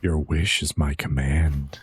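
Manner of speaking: low, slow rumbling purr; sultry voice. Shown as a demonstration. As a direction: drop it deep and slow. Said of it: "it has to go up".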